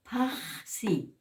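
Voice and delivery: PAXI with a long rasped breath before the si; aspirated J. Shown as a demonstration. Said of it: "la J de la H".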